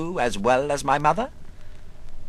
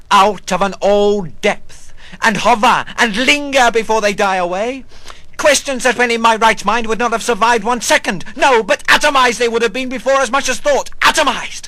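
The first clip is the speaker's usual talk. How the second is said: rising agitation; 6 semitones higher, 9 dB louder, faster than usual. Acted. Agitated rush of questions.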